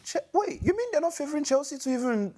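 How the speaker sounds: high, disbelieving protest; can't believe it, voice up high; incredulous. Real, not acted. Stunned by the odds.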